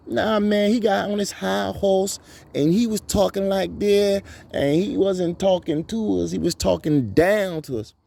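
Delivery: mock him in a high whiny voice. Imitating the man who turns on Cosby, high and mocking.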